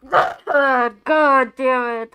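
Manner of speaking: drawn-out loud exasperated groan. Real, not acted. Streamer groans at the letter, loud and drawn out. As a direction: stretch it out in loud dismay.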